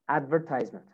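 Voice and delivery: American "advertisement", "tise" rhyming with "size"; American accent. Shown as a demonstration. Teacher models American "advertisement" for students, long I in "tise". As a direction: say "advertisement" as American "AD-ver-tize-ment". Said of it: "American accent".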